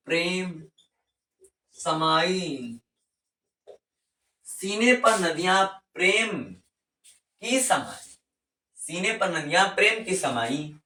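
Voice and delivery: slow, sing-song mnemonic recital. Shown as a demonstration. Teacher repeating the memory line for students, slow sing-song. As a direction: chant it slowly so it sticks. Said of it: "repeating mnemonic slowly".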